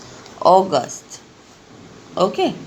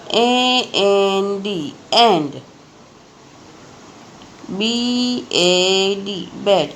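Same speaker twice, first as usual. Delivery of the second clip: letter by letter, then the whole word; spelling aloud; slower than usual. Shown as a demonstration. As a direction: spell each word out slowly for the class. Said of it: "जैसे मैं ऐसे read करती हूँ, ज़ोर ज़ोर से बोलती हूँ". (हर अक्षर बोलकर स्पेलिंग बताना)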